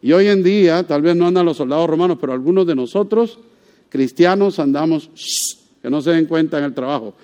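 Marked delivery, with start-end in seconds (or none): 5.1-5.6 soft unvoiced hush; shushing. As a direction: hush them so nobody notices. Cristianos callando su fe. (chistido suave y sin voz)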